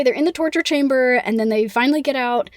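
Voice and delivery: normal talking voice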